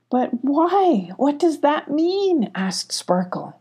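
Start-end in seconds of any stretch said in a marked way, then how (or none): 0.1-2.5 high, drawn-out puzzled questioning; slow, higher-pitched bewilderment; baffled. A narrator's reading. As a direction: plead for an explanation, voice lifted. Sparkle questions, upset and baffled.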